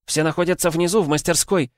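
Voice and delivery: high shouted urging. Acted. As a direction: call it out loud. Huntress shouting on. (громкий крик)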